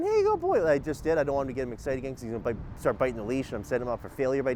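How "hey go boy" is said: very high, bright playful call to the dog; happy and fun. Shown as a demonstration. Trainer showing his happy fun side to the dog, high playful call. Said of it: "happy and fun".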